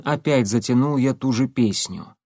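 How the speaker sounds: ordinary delivery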